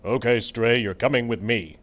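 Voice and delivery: loud, stern warden; gruff officer. Acted. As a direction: bark the order like a cop.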